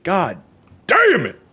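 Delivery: sudden high angry shout; frustrated yell. Real, not acted. Frustrated gamer after failing.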